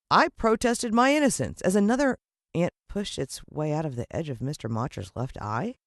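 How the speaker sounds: plain reading voice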